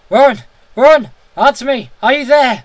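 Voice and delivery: high, loud desperate calls; screaming his name. A narrator's reading. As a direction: scream into the telephone for an answer. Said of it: "called, shouted and screamed".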